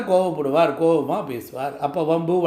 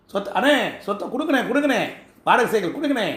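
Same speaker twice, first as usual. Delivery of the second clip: higher, quick wheedling plea; mock pleading; 4 semitones higher, faster than usual. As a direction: beg an elder brother, hammed up. Acts out pleading for property. (கெஞ்சுவது போல நடிப்பு)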